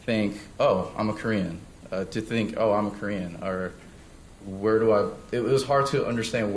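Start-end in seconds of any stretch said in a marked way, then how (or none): none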